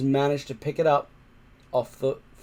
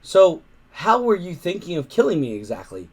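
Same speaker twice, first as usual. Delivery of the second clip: nervous politeness; 5 semitones higher, 5 dB louder than usual. Acted. Boy stalling the monster.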